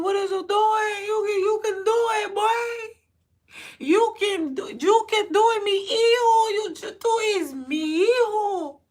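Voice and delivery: shouted, emotional hype; high mock-tearful yell. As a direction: belt it like a tearful proud father. Reenacting cheering on his son.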